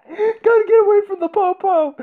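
high, laughing squeal through the words. Real, not acted. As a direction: giggle out the line in a high voice. Laughing hard at the game.

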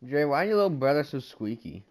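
ordinary talk, nothing marked

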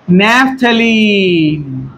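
very slow, trailing long syllables; drawn-out dictation. Real, not acted. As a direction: stretch the name out slowly. Dictates name slowly while writing.

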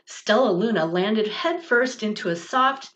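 plain reading voice

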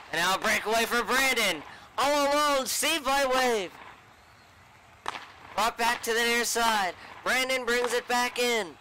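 lively high play-by-play; rapid hockey play-by-play. Acted. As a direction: call the breakaway live. Live play-by-play.

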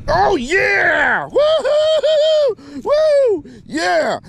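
very high whooping celebration; real, elated victory yells; jubilant. Real, not acted. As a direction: whoop and cheer with the voice soaring. Celebrating the catch.

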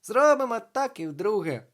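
high, loud playful resolve; gleeful. A narrator's reading. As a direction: pipe up with loud delight. Gleeful ghost plans another prank.

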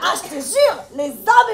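ordinary delivery

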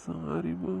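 weep as you look at her; breaking voice, sorry through tears; crying. Acted. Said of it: "டேய், அழாதடா".

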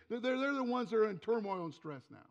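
ordinary talk, nothing marked

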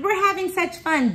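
high, excited storybook cheer; gleeful shout. A narrator's reading. Two monkeys enjoying their bike ride, gleeful cheer. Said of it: "the two shouted".

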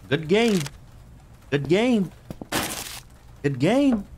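high, sing-song good game; mock good game. Player mockingly conceding to the monster, high sing-song.

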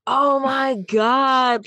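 drawn-out, high, stunned "oh my God"; stunned gasp. Retold or imitated. Reader floored by a twist, slow high gasp.

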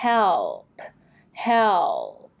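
"help" drawn out, falling to a released P; audible final P. Shown as a demonstration. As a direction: stretch "help" and finish on a clear P. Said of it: "ขอออกเสียง P ด้วยนะคะ". (ลากเสียง help แล้วปล่อยเสียง P ท้ายคำ)

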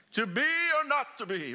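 mock theatrical Hamlet; dramatic Shakespeare. Shown as a demonstration. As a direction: declaim it like a stage actor. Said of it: "You have to say it like that".

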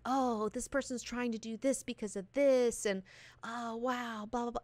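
soft, put-on gullible voice; mocking naive chatter. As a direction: parody wide-eyed theorizing. Mocking people wrapped up in ideals.

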